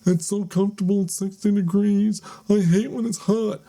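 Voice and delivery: high, loud whiny mock voice; mocking Florida locals. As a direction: squeal it like a smug retiree. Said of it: "and the people down here".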